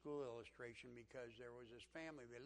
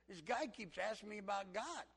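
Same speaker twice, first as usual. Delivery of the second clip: raised, louder country voice; country accent; 6 semitones higher, 6 dB louder than usual. Retold or imitated. Country man telling class about a coworker, country accent. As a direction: imitate an earnest country fellow. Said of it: "he just talked like this".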